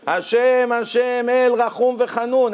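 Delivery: ליל סליחות בצעקה רמה; screamed prayer. Shown as a demonstration. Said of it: "Everyone scream".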